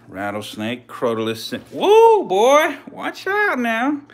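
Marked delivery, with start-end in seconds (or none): 1.7-4.0 high, loud startled cry; startled whoa. Real, not acted. Startled by the lunging rattlesnake, high loud cry. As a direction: jump back from the snake. Snake lunges at him.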